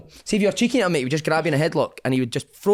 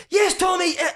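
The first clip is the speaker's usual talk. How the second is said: high-pitched imitated call. Teammate shouting for the ball in training, quick call. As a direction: call for a pass.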